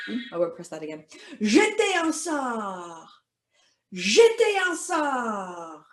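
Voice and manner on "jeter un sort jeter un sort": higher, slow French incantation; casting a spell. Pretend. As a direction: cast it with a long falling "sort". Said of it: "cast a spell".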